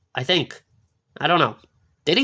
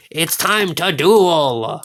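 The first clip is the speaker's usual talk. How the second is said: slow duel cry; much slower than usual. Pretend. Repeats duel catchphrase, lower.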